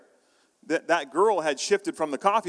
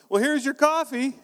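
higher, louder bright barista voice; cheerful fix of the mix-up; other barista; 11 semitones higher, 4 dB louder than usual. Retold or imitated. Coworker handing him the right coffee, high and bright. Second barista correcting order.

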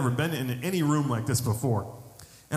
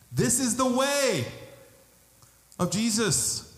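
rising, fervent sermon appeal; preaching; 4 semitones higher than usual. Acted. Pastor urges the congregation to be baptized in God's love, preaching fervently.

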